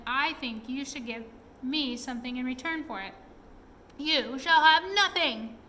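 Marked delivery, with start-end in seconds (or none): 4.0-5.6 loud defiant cry, pitch up; Chingy flings it back, loud and defiant; cried out. A narrator's reading. Pixie refusing the Snoogle, loud defiant cry. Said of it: "cried Chingy".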